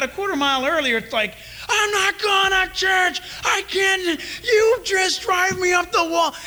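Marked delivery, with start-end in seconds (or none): 1.7-6.3 1 mock tearful shouting. Family fight in car before church.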